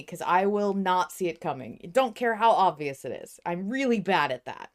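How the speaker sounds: usual speaking voice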